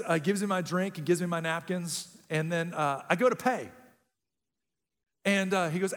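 normal talking voice

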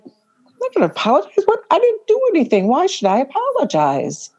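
high, rising, outraged refusal; indignant protest. Retold or imitated. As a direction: squeak out a defiant won't-apologize. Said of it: "And I'm like".

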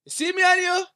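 high, loud bad Caribbean accent. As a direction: ham up a street-guy Caribbean voice. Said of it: "That was a terrible Caribbean accent".

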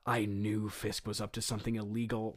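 lightly acted hushed aside; quiet stage aside. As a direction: confide it quietly to the audience. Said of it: "Spider-Man says quietly to the audience".

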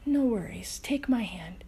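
soft unvoiced calm words; hushed calm storyteller; whispered reassurance. A narrator's reading. Reassuring Lukey, quiet story.

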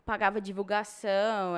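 normal talking voice

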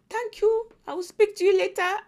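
gladly ending the call; happily getting rid of someone; cheerful dismissal. Retold or imitated. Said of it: "and then I will say".

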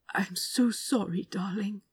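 soft, voiceless, tender whisper; comforting Anathema with a whispered sorry; whispered apology. Acted. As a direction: murmur it close to her ear.

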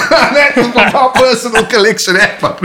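cackling at his own joke; laughing. Real, not acted. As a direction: crack up while delivering it. Punchline told through laughter.

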